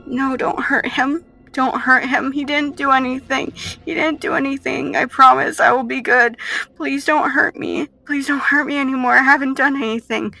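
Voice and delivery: put-on high-pitched child begging for mercy; Joy. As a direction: plead urgently as a scared little girl.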